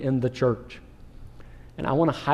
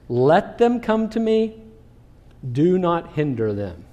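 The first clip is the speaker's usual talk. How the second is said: Jesus giving commands; slower than usual. Acted. Jesus giving two commands about the children, slow firm command. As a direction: lay down each order slowly and firmly. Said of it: "he gives two commands".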